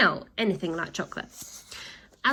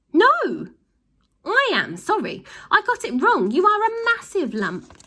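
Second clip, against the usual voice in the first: very high, loud flustered voice; squeaky husband; 12 semitones higher, 7 dB louder than usual. A narrator's reading. Mister Glutton, squashed and flustered, corrects himself.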